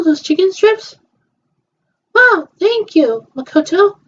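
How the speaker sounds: high, bright-eyed play character; a doll chatting after check-in; girly doll voice. Pretend. Voicing toy girls at hotel.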